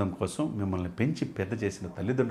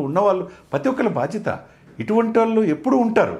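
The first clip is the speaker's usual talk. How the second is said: raised, higher, louder pleading to the nation; impassioned appeal; 8 semitones higher, 3 dB louder than usual. Real, not acted. Debate guest rallying citizens to duty, impassioned and loud. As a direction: push every line up with fervour.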